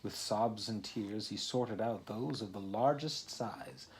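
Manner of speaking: plain reading voice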